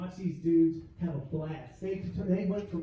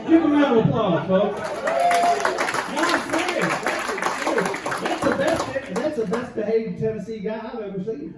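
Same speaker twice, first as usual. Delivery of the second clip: much louder and higher; hype shout; 6 semitones higher, 10 dB louder than usual. Real, not acted. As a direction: whip up applause for him. Hyping up the crowd.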